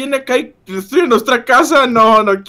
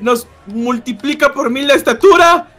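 high, slow astonished cry; awed fright; 5 semitones higher, slower than usual. Acted. Kid seeing the giant monster, high astonished cry. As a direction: gasp at the monster's size.

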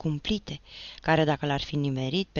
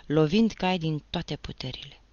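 ordinary delivery

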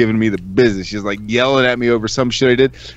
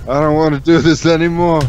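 "do this" pushed higher and louder in mock despair; louder, exasperated take of "I don't wanna do this anymore"; 5 semitones higher, 5 dB louder than usual. Real, not acted. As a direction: groan it out, fed up. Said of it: "I can't".

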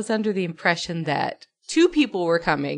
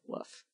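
a very soft, limp "Woof"; a lazy, barely-there bark; bored dog woof; 21 dB quieter than usual. Retold or imitated. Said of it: "How did he bark?".

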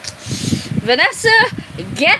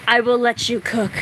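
ordinary talk

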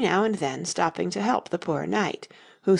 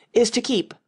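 loud, higher proclaiming cut off short; 5 semitones higher, 4 dB louder than usual. A narrator's reading. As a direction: declaim it, then break off. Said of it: "began in a loud voice".

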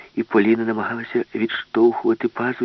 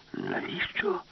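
much higher, softer wondering ask; puzzled question; 9 dB quieter, much slower than usual. Acted. Alice asks the professor why, high soft puzzled. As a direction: ask in bewilderment.

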